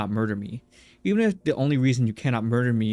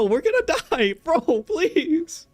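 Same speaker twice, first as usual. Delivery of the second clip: panicked laugh; slower than usual. Real, not acted. Panicking at game threat, laughing.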